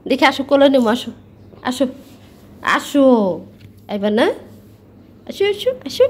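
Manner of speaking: cooing come here, come here to a pet; coaxing a pet bird. Real, not acted. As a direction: coax the little bird onto your lap.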